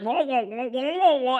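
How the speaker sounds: muffled trombone-like wah wah of an adult droning; Charlie Brown teacher. Retold or imitated. Friend mimics the Charlie Brown teacher for a psychologist, muffled wah-wah drone. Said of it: "the teacher sounds like".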